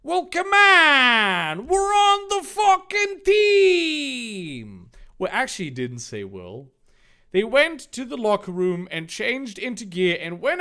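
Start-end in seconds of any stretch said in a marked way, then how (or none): 0.0-4.9 very high, slow, loud yell; slow high shout. Acted. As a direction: cheer at the top of lungs. Dave shouting.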